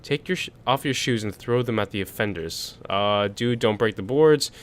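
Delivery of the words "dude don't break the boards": ordinary talk, nothing marked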